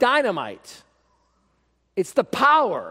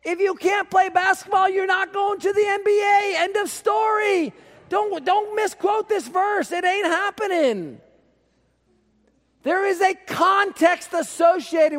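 preaching rebuke; 8 semitones higher than usual. Real, not acted. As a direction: hammer the warning home from the pulpit. Preaching rebuke at full force.

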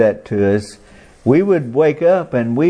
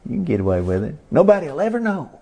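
softer, coaxing voice in the mind, lifting at the end; tempting inner voice; 4 dB quieter, faster than usual. Acted. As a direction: play the quiet little tempter brushing off worries. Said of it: "you hear this voice in your mind saying".

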